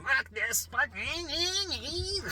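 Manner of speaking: squeaky, hammed-up flash fear; panicking amygdala. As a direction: play the brain's alarm taking the wheel. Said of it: "The amygdala is driving".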